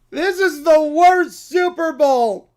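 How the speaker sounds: slow, loud bratty whine; whiny loud complaint. Acted. Brat whining to the TV about the matchup, loud and slow. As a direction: wail it like a spoiled kid.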